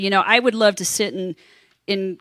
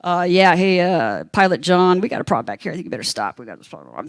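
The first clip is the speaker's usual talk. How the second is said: lower, confidential, sinking to a murmur; attendant phoning pilot; 5 semitones lower than usual. Retold or imitated. Attendant calls Pilot John about problem, low discreet voice. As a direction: drop low and fade as if discreet. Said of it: "so she goes over to the phone".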